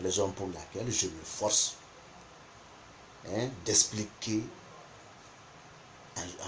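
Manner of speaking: ordinary talk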